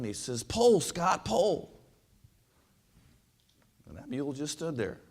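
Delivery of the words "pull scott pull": high, drawn-up holler of command; acted scream. Farmer urging the unmoving mule to pull, acted scream. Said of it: "the farmer screamed again".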